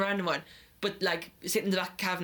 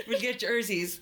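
laughing; 4 semitones higher, slower than usual. Real, not acted. Laughing through words.

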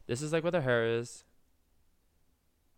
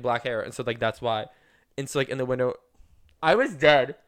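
ordinary talk

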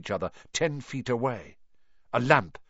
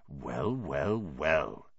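low, soft menacing whisper; caretaker's quiet gloating whisper; 6 semitones lower, 7 dB quieter, much slower than usual. A narrator's reading. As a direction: savour catching them, slowly and softly. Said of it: "he whispered".

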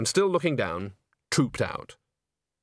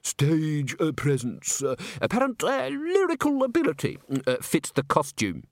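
flustered opera manager; 3 semitones higher than usual. Acted. Men all talking at once to justify the casting, flustered. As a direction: grope awkwardly for each tactful phrase.

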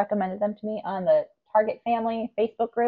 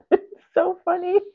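high giggly laugh; 10 semitones higher, 7 dB louder than usual. Real, not acted. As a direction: laugh the words out. Laughing at impression.

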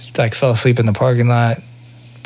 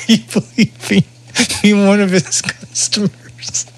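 high laughing voice fading to a whisper; 8 semitones higher, slower than usual. Real, not acted. Asking his friend about the jet ski guy while laughing. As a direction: laugh through the question.

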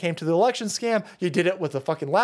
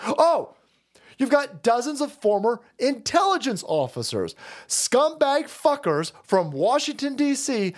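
ordinary delivery

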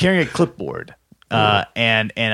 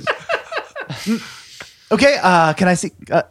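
officious woman voice; 6 semitones higher than usual. Acted. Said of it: "she looks at".

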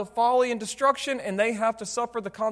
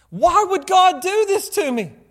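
loud, high-pitched outraged cry; acted indignant wail of complaint; angry at God; 9 semitones higher, 8 dB louder than usual. Preacher acting someone suffering consequences, angry cry at God. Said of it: "they get angry at God".

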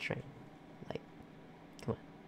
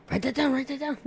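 squeaky high voice; 12 semitones higher, 4 dB louder than usual. Pretend. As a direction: squeak it out playfully. Playful high chant.

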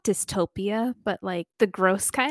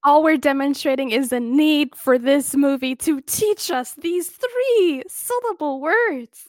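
mock grand; 6 semitones higher, 6 dB louder, slower than usual. Mock grand statement, laughing.

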